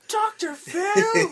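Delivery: very high, slow, stretched-out yell; crazed shout. Retold or imitated. Doctor Shelby losing it at the end, screaming. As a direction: scream the name like a madman. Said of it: "he's like".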